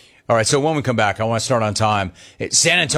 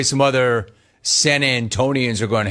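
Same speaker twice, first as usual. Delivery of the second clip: normal talking voice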